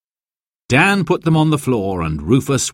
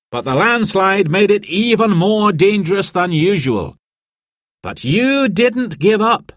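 raised, formal announcing voice; team leader's speech; 10 semitones higher than usual. A narrator's reading. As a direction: address the crowd like a proud rescue leader.

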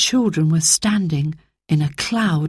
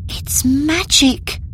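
slow, higher awed whisper; 9 semitones higher, slower than usual. A narrator's reading. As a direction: breathe it in wonder. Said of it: "whispered Phyllis".

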